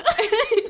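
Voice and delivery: grand rire aigu qui part tout seul; éclater de rire, franchement et haut. Real, not acted. (fou rire franc, voix qui monte)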